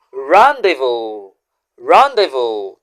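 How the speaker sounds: "Rendezvous" said slowly as rahn-day-voo, the z and s silent; "Rendezvous" with a nasal first vowel and no final s; French pronunciation. Shown as a demonstration. Teacher repeating "Rendezvous" for viewers, with the z and s silent. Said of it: "The letters Z and S in that word are both silent".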